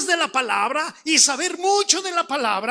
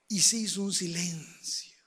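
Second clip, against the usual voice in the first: low, whispery narrating hush; 6 semitones lower, 7 dB quieter than usual. Real, not acted. Preacher telling how the village fell silent, hushed. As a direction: let the voice sink almost to a whisper.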